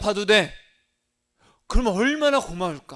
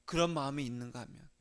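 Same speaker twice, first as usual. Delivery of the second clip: usual speaking voice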